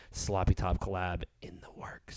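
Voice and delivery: whispered teaser; hushed secret. Real, not acted. Host whispering a secret collab to listeners.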